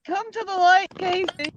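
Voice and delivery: high sing-song invitation; high teasing. Pretend. Teasing Casey to join.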